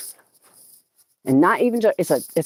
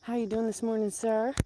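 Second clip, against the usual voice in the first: out of breath; 4 semitones higher, 9 dB quieter than usual. Real, not acted. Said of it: "I'm a little out of breath".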